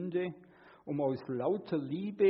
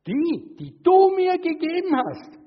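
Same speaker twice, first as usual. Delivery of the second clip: much higher and louder accusing whine; shrill-free mock accusation, pitched way up; blaming; 15 dB louder, slower than usual. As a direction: point the finger straight back at God. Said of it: "und sagt".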